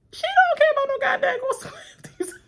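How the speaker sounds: high loud cackling shout; laughing shout. Real, not acted. Laughing rant.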